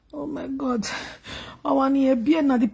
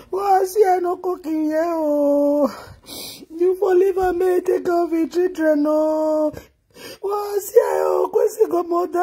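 real wailing lament; 2 semitones higher than usual. Real, not acted. Mourner telling the boy he should have lived, wailing. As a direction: cry out as if pleading with the dead. Wailing in grief over a death.

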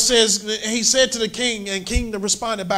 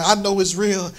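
ordinary talk, nothing marked

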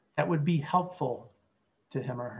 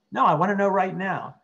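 demanding patient; 5 semitones higher, 9 dB louder than usual. Acted. Role-played patient demands answer.

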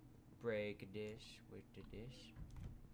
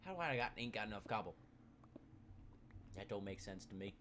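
normal talking voice

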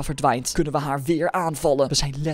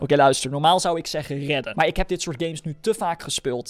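usual speaking voice

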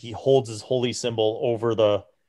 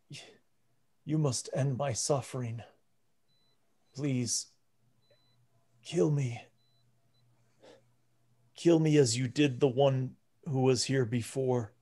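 soft, weary plea from a suffering priest; quiet pleading; 3 semitones higher, 4 dB quieter than usual. Acted. Suffering priest begs the party to kill him, quietly pleading. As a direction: beg gently for release.

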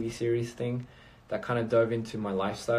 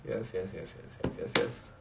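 fading yeses; 3 semitones lower, 6 dB quieter than usual. Real, not acted. Playful trailing repeated yes.

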